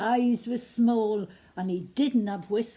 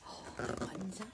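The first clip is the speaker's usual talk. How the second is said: hushed aside; 3 semitones lower, 13 dB quieter, faster than usual. Real, not acted. Aside to listeners.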